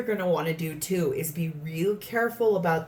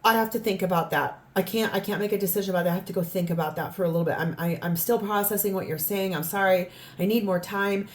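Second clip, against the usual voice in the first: flustered stalling; faster than usual. Retold or imitated. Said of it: "they, they".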